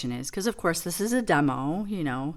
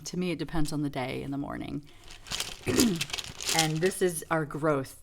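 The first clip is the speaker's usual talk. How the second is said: ordinary talk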